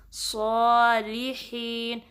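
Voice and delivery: صالحین slow, with a heavy ص and full alif; heavy saad. Shown as a demonstration. Teacher showing students the heavy letter. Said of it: "پر پڑھیں گے".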